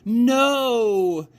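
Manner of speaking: put-on high, loud girl's scream. A narrator's reading. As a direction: scream to stop them. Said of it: "screamed Abilene".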